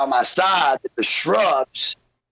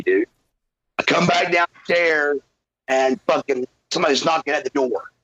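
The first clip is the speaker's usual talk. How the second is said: ordinary talk, nothing marked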